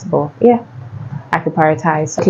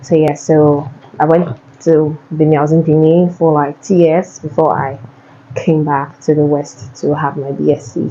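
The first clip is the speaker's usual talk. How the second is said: ordinary talk, nothing marked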